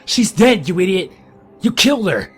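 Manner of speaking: loud, high grief-stricken rage; furious scream. Acted. Grieving old man screams furiously at the driver. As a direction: tear into him for killing her.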